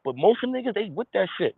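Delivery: usual speaking voice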